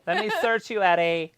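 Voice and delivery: high, loud put-on holler; mock shout. Playing out a search of Eddie, mock shouted. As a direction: bark it as a playful order. Same acted bit, co-host laughing.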